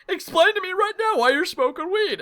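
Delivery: very high mock-mom demand; hammed-up angry mother, way up in pitch. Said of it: "She was so angry and so pissed off".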